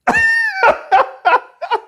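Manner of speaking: high loud burst of mock laughter; imitated audience laugh. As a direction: do the whole crowd bursting out laughing. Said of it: "everyone in the audience just went".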